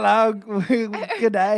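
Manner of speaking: loud, laughing mock Cockney greeting; cockney impression. Friend imitating a Cockney date, laughing loud Cockney. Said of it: "really cockney".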